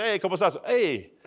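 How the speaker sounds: ordinary delivery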